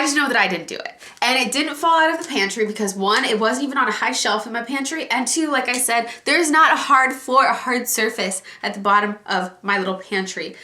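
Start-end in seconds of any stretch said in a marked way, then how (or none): none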